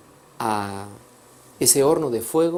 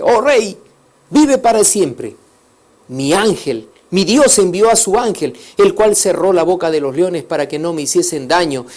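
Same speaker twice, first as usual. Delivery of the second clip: loud, higher, earnest voice from below; Daniel replies; 4 semitones higher, 6 dB louder than usual. Acted. Daniel answers the king from the lions' den, loud and earnest. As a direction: declare your innocence to the king.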